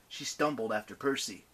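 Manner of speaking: usual speaking voice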